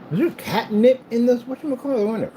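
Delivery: high-pitched playful questioning; pet talk. Real, not acted. Owner wondering aloud about catnip, pet talk.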